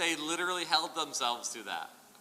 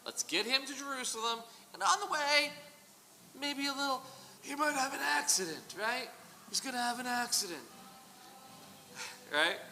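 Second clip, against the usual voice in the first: higher, menacing mob boss hinting at an accident; Godfather impersonation; 9 semitones higher than usual. Pretend. Plotting priests hinting at a hit, Godfather voice. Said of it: "That was my best Godfather impersonation".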